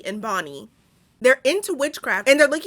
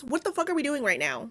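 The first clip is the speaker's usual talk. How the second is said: normal talking voice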